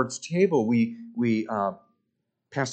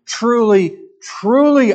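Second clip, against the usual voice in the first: "Truly, truly" both hit much higher and louder; emphatic repetition; 8 semitones higher, 9 dB louder, slower than usual. Acted. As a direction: ring out each "truly" with full force. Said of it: "He's emphasizing with that double repetition".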